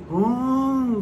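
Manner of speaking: imitated engine rev. Shown as a demonstration. Mechanic showing how a car accelerates by itself, imitated rev. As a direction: hum like an engine racing on its own.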